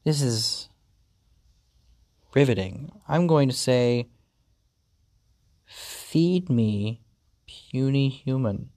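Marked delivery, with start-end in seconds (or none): 5.6-8.7 slow, measured, lightly voiced cat overlord; hint of a haughty pet giving orders; commanding pet. Cat orders human to feed it, lightly imperious.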